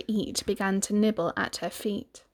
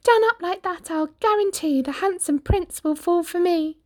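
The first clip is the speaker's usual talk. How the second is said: put-on high, girlish storybook voice; Cinderella's voice; 9 semitones higher than usual. Cindy telling the fairy her wish, high and eager. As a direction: voice her giddy with hope.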